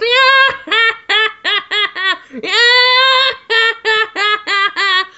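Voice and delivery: high, loud cackling in long bursts; mock laughter of many children. Host imitates the laughter of thousands of children, high cackle. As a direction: imitate an eerie crowd of laughing kids. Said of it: "laughter is like thousands of children".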